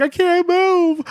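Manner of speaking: very high panicked cry; mock panic. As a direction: squeal it like a man frozen in terror. Acted quote, trapped man panicking.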